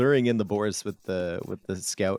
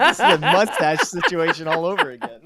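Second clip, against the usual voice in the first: laughing; 5 semitones higher, 6 dB louder than usual. Real, not acted. Laughing at his own joke.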